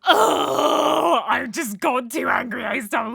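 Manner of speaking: real crying. Real, not acted. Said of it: "'cause I'm crying".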